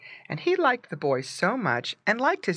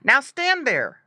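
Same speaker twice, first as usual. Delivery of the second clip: high, loud, slow command; boy's raised, high voice; crying out; 9 semitones higher, 6 dB louder than usual. A narrator's reading. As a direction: call out a firm order slowly. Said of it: "the boy cried".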